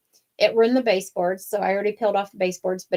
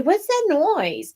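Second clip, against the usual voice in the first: puzzled question; 9 semitones higher, much slower than usual. Retold or imitated. Hears a strange noise at home.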